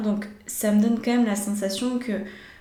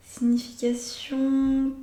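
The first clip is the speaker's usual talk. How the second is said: slow, higher, stretched Signification; drawn-out Signification; 5 semitones higher, slower than usual. Shown as a demonstration. Reader drawing a tarot card, stretching Signification slowly. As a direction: savour the word Signification as she draws the card.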